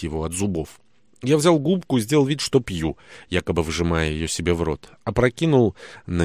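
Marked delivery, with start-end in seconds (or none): none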